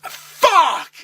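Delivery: loud, high curse; cursing the news out loud; dismayed yell. Retold or imitated. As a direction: blurt it out in dismay. Reaction to the bad news.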